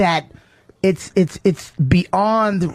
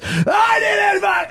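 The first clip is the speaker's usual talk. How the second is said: much higher sudden shouted outburst; imitated rage snap; slower than usual. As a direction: snap into a mock rage yell. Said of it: "and he goes".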